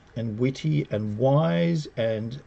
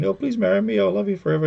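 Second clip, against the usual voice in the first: higher-voiced pleading suitor; mock romantic proposal; 6 semitones higher, faster than usual. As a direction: propose in mock lovestruck earnest. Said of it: "does all the romantic routine".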